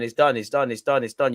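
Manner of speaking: ordinary talk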